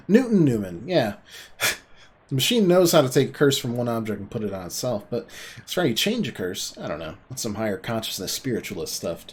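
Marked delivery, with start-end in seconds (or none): none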